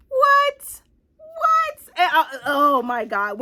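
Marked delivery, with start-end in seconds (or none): 0.1-1.8 very high, loud squeal of disbelief; reenacted high-pitched disbelief; incredulous shock. Retelling her disbelief at the reveal.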